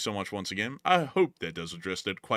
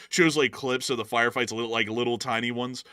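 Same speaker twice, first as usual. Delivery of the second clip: ordinary delivery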